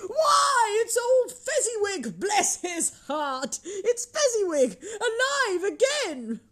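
high, loud joyful cry; drawn-out, high-pitched exclamations of delight; great excitement. A narrator's reading. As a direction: cry out in overjoyed recognition. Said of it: "Scrooge cried in great excitement".

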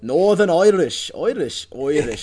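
loud Northern Irish, "Irish" said like "oi-rish"; Northern Irish accent. Shown as a demonstration. Co-host shows the only words he knows, Northern Irish accent. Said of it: "words I know how to say in that accent".

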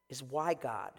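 ordinary delivery

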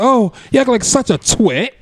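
put-on high, slow, laughing English accent; laughing through an English accent; clumsy practiced English accent. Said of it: "I think they were working on my accent".

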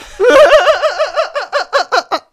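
long, loud, high cackling laugh; real high-pitched laughing fit. Real, not acted. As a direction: burst out laughing at your own joke. Laughing at mushroom joke.

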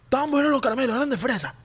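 high, squeaky mock child; put-on childish excuse; little kid voice. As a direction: gush like a kid defending sweets. Plays child defending the candies.

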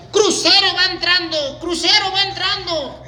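high, slow dockside call; hollered announcement. Retold or imitated. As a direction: holler the cruise ship's arrival, drawn out. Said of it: "yo gritaba".

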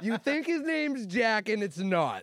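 high, slow, put-on voice; mock dramatic reveal. Reveal to the others that John lies, in a high slow voice. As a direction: stretch it out like a big secret. Teasing reveal about friend's name.

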